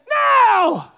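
high, drawn-out imperious yell; imitating a bossy kid's holler; audacious demand. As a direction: shout it like a demanding child. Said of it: "had the audacity to say".

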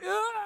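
high-pitched mock wail; whiny fake sob; crying. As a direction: imitate a woman bawling. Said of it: "she's crying".

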